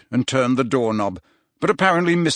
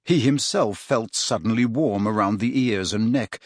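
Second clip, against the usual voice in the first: usual speaking voice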